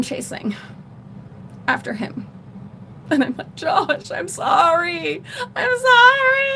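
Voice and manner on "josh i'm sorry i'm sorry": anguished apology. Retold or imitated. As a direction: plead with him, rising into a cry. Apologizing as boyfriend flees.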